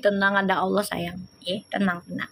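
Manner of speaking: reassuring. Real, not acted. Calming a scared viewer.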